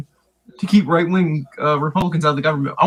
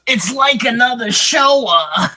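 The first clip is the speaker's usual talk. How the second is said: slow exaggerated Yiddish accent; 4 semitones higher, much slower than usual. Mock stereotyped voice.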